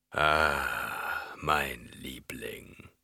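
slow, low endearment fading into a whisper; Baron. A narrator's reading. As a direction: croon it softly, drawn out. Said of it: "fuhr der Baron fort". (Baron, tief und langsam gehaucht)

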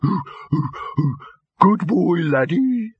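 high, cooing mock praise. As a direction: coo it like talking to a pet. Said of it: "sneered Gaspode".

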